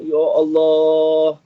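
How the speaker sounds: long drawn-out, held wail, imitating mother; retold tearful cry. Mother receiving Afiq at the gate, imitated tearful cry. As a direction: imitate a weeping mother's slow exclamation. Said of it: "mak saya menangis".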